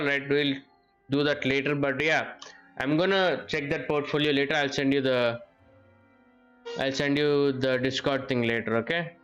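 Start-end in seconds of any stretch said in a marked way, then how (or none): none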